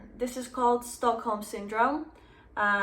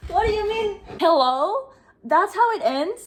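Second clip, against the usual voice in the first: loud, high, incredulous outburst; shocked disbelief; 9 semitones higher, 8 dB louder than usual. Real, not acted. Reader reacting to an abrupt ending, shocked disbelief. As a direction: cry out in outraged disbelief.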